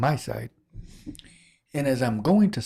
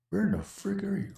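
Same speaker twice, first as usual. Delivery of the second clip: mild exasperation; 9 semitones higher, 4 dB quieter than usual. Real, not acted. Hunting for his lost mouse.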